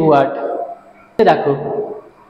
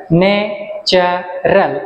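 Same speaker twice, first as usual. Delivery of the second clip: Natural in three beats, ন্যা, চা, রাল; slow natural with short gaps; syllable split; much slower than usual. Shown as a demonstration. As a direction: separate each syllable of natural. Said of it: "তিনটা syllable আছে".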